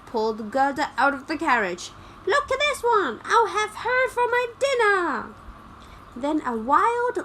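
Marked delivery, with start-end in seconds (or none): 2.3-5.3 high, loud hag's cry over her catch; shrill robber crone claiming her dinner; greedy old robber woman. A narrator's reading.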